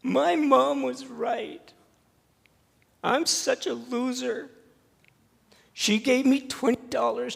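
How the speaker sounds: put-on high, loud, wailing child's voice; a ten-year-old blubbering through tears; crying little boy. Sobbing boy telling the pastor he lost the money, in a high, crying child's voice. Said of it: "he's just sobbing".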